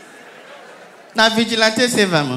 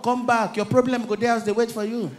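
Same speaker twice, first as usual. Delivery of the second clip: ordinary talk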